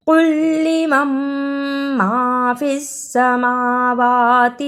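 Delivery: slow melodic tajweed chant; Quran recitation. Shown as a demonstration. Teacher reciting Quran verse to students, melodic chant.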